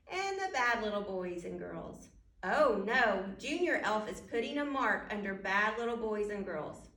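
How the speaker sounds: ordinary delivery, nothing marked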